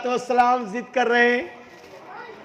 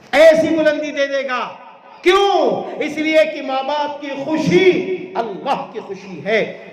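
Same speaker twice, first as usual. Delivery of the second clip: higher and louder, thrown out to the crowd; rising preacher cry; 5 semitones higher, 3 dB louder than usual. Acted. Preacher crying out to the congregation. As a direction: lift it into a call. Repeated phrase, rising climax.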